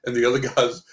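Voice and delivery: usual speaking voice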